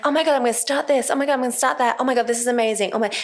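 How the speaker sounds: high, fast breathless enthusiasm; mock gushing excitement. Imitating creatives starting new projects, gushing excitement. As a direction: rattle off each new idea as if thrilled.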